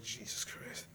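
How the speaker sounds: breathed under the breath; whispered oath. Acted. Logan stunned by the Rat King, breathed whisper. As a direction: gasp it almost silently. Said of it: "I'm like".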